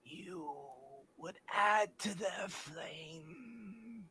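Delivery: very slow, hushed ghostly whisper; drawn-out spectral whisper; whispering flame entity. Acted. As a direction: whisper each phrase slowly, with ominous weight. Entity speaks through game master.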